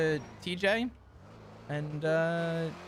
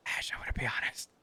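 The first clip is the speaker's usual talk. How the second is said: whisper; 5 semitones lower, 10 dB quieter, faster than usual. Real, not acted. Whispering back on the call.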